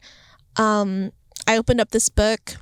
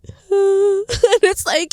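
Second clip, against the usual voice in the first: laughing cry; 10 semitones higher, much slower than usual. Real, not acted. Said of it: "I'm crying".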